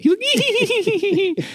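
high squealing giggle like a piglet; piglet laugh. Retold or imitated. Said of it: "He's like this, like, little piglet".

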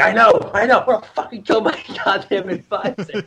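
high, mock-furious agreeing; acted-out indignation breaking into a laugh; fired-up agreement. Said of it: "just throws fuel on the fire".

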